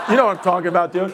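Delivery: ordinary delivery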